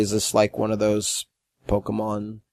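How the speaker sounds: low, quiet, halting guard voice; puzzled security guard. Retold or imitated. Security guard questioning a hotel room party, puzzled and halting. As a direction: imitate a guard groping for the word.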